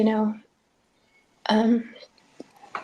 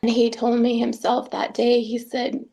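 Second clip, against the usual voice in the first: normal talking voice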